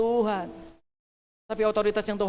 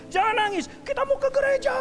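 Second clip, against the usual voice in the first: much higher, louder mock scolding; exasperated parent; 4 dB louder than usual. Parent scolding the crying child in the car, exasperated.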